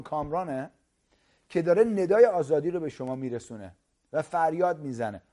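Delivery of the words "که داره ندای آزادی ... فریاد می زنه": ordinary delivery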